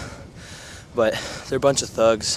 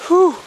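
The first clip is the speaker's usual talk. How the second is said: sharp high whew; 9 dB louder than usual. Real, not acted. Reacting to freezing cold.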